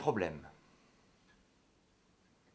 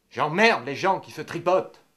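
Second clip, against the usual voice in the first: loud, slow, higher-pitched contempt; lashing out with disgust, raised voice; contemptuous rant; 5 semitones higher, 8 dB louder than usual. Acted. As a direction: drag out the outburst angrily. Ranting against couples who fondle.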